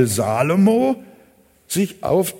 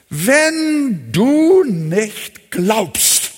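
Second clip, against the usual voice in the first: very slow, louder, each word set apart; a preacher slowly warning the doubter; slow solemn warning; 2 semitones higher, 4 dB louder, much slower than usual. Acted. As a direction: drag it out like a grave warning.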